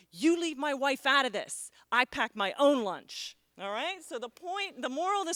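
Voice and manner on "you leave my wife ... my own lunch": louder, indignant snap, slower on the second line; indignant punchline. Retold or imitated. Joe snapping at Bob over his wife, louder and indignant. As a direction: bark the punchline back, offended. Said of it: "And his friend says".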